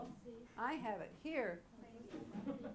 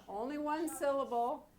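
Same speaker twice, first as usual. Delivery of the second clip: usual speaking voice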